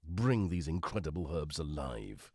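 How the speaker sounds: low, grand product-blurb read; advert voice-over. Pretend. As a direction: read the packet like a dramatic advert.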